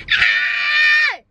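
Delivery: high, loud scream on demand; sudden high-pitched shriek; screaming voice demo. Shown as a demonstration. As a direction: show your own screaming voice, high and loud. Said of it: "my screaming voice is like this".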